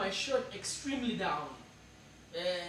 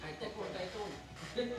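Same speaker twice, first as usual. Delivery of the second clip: laughing; 8 dB quieter, slower than usual. Pretend. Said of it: "you said I sound Afrikaans".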